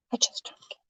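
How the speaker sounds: breathy whisper with no voice at all. Real, not acted. Shrugging off her weight gain to viewers, whispered. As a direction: murmur it as if only to yourself.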